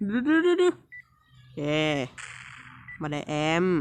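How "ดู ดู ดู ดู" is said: rising, excited urging; eager repeated look look. Real, not acted. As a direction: egg the screen on, higher. Watching a tense moment.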